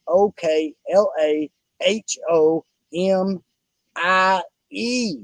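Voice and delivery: slow, letter-by-letter spelling of "O K L A H O M I E", ending on "I E"; spelled out slowly. Shown as a demonstration. Host spelling the promo code slowly for listeners. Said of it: "Use the promo code, all caps".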